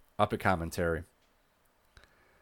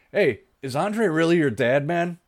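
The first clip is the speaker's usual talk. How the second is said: lightly voiced wrestler, higher and louder; Scott Hall; 7 semitones higher, 5 dB louder than usual. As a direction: imitate a wrestler tossing out a nosy question. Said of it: "Scott Hall".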